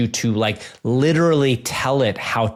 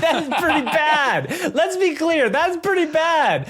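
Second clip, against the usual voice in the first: mock disbelief. Mocking the bad scared read.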